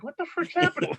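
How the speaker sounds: high-pitched startled panic; alarmed. Retold or imitated. Patient on the table, high panicked surprise. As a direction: squeak out the alarm.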